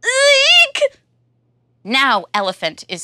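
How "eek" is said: loud squealing shriek; high terrified eek. Acted. Elephant fleeing the mouse with a high loud eek. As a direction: squeal in pure panic. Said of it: "cries Elephant".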